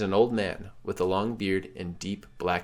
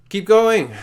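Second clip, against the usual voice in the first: loud, high rallying yell; urgent shout; 11 semitones higher, 11 dB louder than usual. Acted. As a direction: shout them through the stampede. Urging the player on again.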